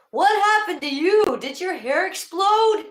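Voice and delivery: very high, loud mock shout; astonished yell. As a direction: holler in comic disbelief, stretching the last words. Acts out neighbor's shocked yell.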